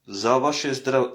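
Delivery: ordinary talk, nothing marked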